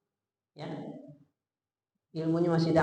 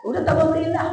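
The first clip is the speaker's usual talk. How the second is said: offended; 10 semitones higher, 6 dB louder than usual. Retold or imitated. Husband's offended retort.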